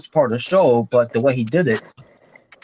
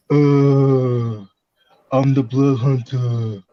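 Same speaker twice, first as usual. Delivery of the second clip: slow groaning zombie wrestler voice; much slower than usual. Shown as a demonstration. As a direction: drag it out like a dead-eyed zombie. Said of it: "I gotta do this right".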